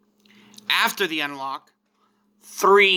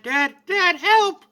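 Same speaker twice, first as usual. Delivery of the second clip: panicked cry for help; 13 semitones higher, 3 dB louder, slower than usual. Acted. Gohan calls his dad for help.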